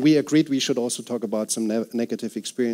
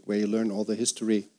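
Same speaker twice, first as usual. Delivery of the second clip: normal talking voice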